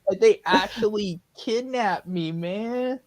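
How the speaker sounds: high, slow stoner hippie; spaced-out hippie. Pretend. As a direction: drift through it like a stoned hippie. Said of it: "you sound like a hippie".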